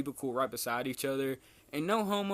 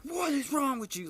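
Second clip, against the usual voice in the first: high-pitched mock outburst of outrage; going crazy; 13 semitones higher, 4 dB louder than usual. Teacher blows up at clumsy student, retold outrage. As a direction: imitate a teacher losing it, pitch shooting way up. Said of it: "he goes crazy".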